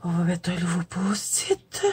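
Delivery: soft whisper to oneself. Real, not acted. Vlogger putting on a new post-it, whispering. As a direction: whisper it while busy with your hands. Murmuring to self while working.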